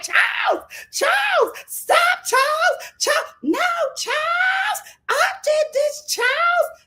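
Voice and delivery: high, frantic imitated screams of the name; mock screaming. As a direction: shriek after him in panic. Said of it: "screaming at the top of her lungs".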